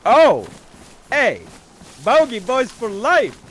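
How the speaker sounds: high, loud rallying shout; excited cheer. Real, not acted. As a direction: whoop it out like a team chant. Cheering the group's name.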